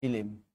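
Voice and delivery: soft, low ফিলিম, as fi-lim; ফিলিম dropped quietly in two syllables; split cluster. Shown as a demonstration. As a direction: say ফিলিম with an added i before m. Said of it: "কি হয়ে যাবে?".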